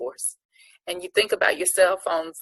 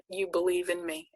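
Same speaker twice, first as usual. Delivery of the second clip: normal talking voice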